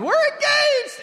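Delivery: very high, loud gushing announcement; mock excited squeal. Couple telling everyone they are engaged, hammed-up excited squeal. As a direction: squeal it like a newly engaged girl.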